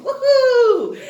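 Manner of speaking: loud, high party whoop; cheering whoop. Acted. Teacher whooping with the class after the chant. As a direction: cheer like on the dance floor.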